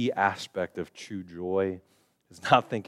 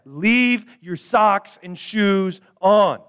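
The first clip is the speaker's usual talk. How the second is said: much higher and louder, slow and stern; exasperated parent; 12 semitones higher, 10 dB louder, much slower than usual. Retold or imitated. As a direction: lay down the law to the kids again. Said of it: "you specifically said".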